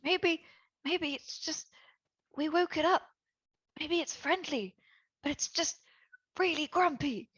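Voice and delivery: soft, halting suggestion; hushed and tentative; timid, hopeful. Acted. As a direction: offer it very softly, in small hesitant pieces. Tentative hope about the monster.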